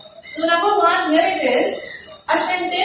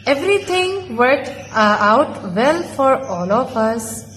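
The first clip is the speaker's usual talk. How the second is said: slow, lower reading with long pauses between phrases; expressive reading aloud; 4 semitones lower, slower than usual. Shown as a demonstration. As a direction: read the story to the class, pausing at every comma. Reads the paragraph aloud.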